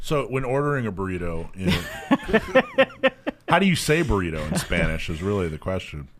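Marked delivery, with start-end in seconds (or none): 1.7-3.4 high burst of real laughter; squealing real laugh; big laugh. Real, not acted. Host cracks up at burrito joke.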